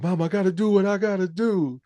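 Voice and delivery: high mock-weary son; imitating the son. Retelling the son answering his mother, high put-on voice. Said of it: "It's like".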